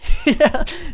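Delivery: a bright burst of laughter; loud high laugh. Real, not acted. Vlogger laughs at the end of the story, loud and high. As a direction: cackle out loud.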